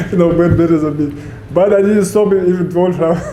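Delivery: words broken by laughter; laughing while talking. Real, not acted. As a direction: chuckle through the line. Laughs at his failed prediction.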